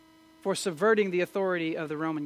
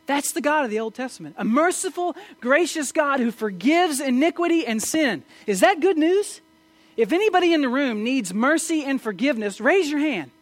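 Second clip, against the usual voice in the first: louder and higher, impassioned pulpit voice; full-force preaching; 6 semitones higher, 6 dB louder than usual. Acted. Preacher pleading with the congregation about guilt: impassioned, loud. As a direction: preach it out, rising to a cry.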